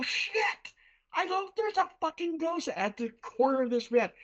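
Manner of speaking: high-pitched retold panic; shocked alarm. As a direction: re-enact a startled, high-pitched outcry. Retold shock at ghost's reply.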